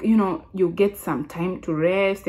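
usual speaking voice

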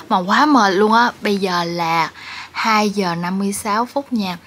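usual speaking voice

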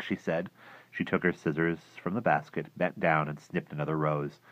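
ordinary delivery, nothing marked

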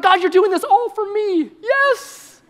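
very high, gushing delight; giddy excitement. Acted. Believer thanking God for doing this all for me, giddy. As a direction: squeal it to God, hammed-up joy. Acts out thrilled new believer.

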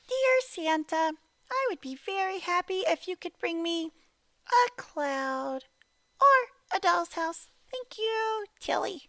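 put-on sing-song it like a tiny polite girl; sweet little girl. Little girl politely asking Santa for gifts, very high and sweet. Letter read in Tilly's voice.

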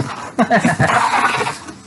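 breathy laugh at his own joke. Real, not acted.

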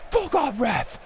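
sudden high yelp of contempt; swearing at ref. Real, not acted. Gamer telling the ref off again, high angry yelp. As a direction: spit it at the ref.

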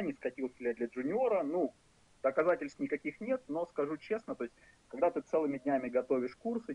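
usual speaking voice

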